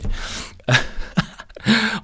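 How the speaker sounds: breathy silent laugh; unvoiced giggle. Real, not acted. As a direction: laugh under the breath at the joke. Laughs at own joke.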